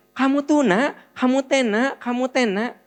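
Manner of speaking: high-pitched scolding mother voice, repeating; nagging parent. Retold or imitated. Mother nagging her child, high and repetitive.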